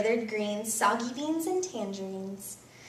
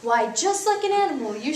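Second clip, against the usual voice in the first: louder, stern dad voice with a laugh; scolding father; 6 dB louder than usual. Acted. Father warns son about talking with food, loud scolding. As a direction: boom the warning like a cross dad.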